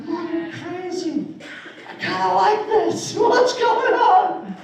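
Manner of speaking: hammed-up bewildered boy impression; young boy voice. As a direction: whine to mom in a child's falsetto. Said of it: "I had a higher voice".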